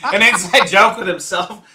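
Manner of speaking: said through a big laugh, high and loud; laughing out the words. Real, not acted. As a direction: crack up while saying it. Laughing at the friend's joke.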